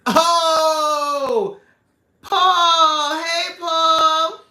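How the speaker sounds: loud, high, real delighted holler; excited greeting. Real, not acted. Host greeting Paul as he joins the live, excited holler. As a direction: call out joyfully to someone just arriving.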